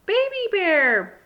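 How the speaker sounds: loud, high, drawn-out surprised shout. A narrator's reading. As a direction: shout out the surprise visitor's name. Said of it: "Big Bird shouted".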